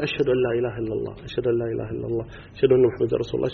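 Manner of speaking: hushed, quick low recitation. Shown as a demonstration. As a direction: murmur it fast under the breath. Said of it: "ثم يرفع صوته بباقي الأذان". (بصوت منخفض وسريع)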